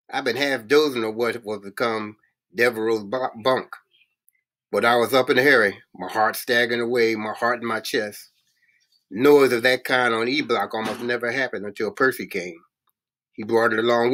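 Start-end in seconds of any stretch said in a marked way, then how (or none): none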